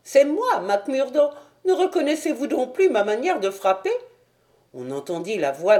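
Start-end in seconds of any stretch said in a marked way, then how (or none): 0.0-3.9 high-pitched, quick little gentleman; high voice, mildly put out; Thaddeus, impatient visitor. Acted. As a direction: answer the doorman in a high, hurried voice.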